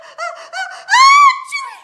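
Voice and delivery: building "ah, ah" climbing to an explosive achoo; acted sneeze. A narrator's reading. Spice tickles the kitten's nose, huge mock sneeze. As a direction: fake a huge, high, loud sneeze. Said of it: "The kitty boy sneezed".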